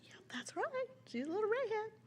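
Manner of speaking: high, hushed mom voice; imitated proud mom. Mom confirming her daughter's costume, high cooing. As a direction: imitate a mother cooing over her child. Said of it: "her mom was like".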